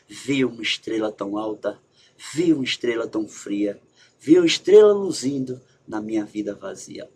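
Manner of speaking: poema recitado baixinho, sem pressa; recitação de poema. Shown as a demonstration. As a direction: declame com ternura. Recita poema de Manuel Bandeira. (recitando o poema suavemente)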